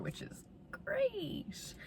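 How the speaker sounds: high, drawn-out sarcastic "great". Real, not acted. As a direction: say it dripping with irony. Two weeks to sell car.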